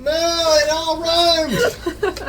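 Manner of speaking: high, slow, laughing lament; mock wail. Lamenting the rhyming prophecy, mock wail.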